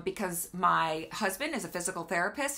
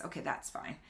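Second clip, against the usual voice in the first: under her breath, to herself; a quick unvoiced aside; whispered aside; 5 semitones lower, 10 dB quieter than usual. Real, not acted. Muttered aside while setting up.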